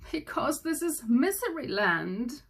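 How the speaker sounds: mild weepy whine, slowed; a touch of tearful king; lightly weeping king. A narrator's reading. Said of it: "wept the king".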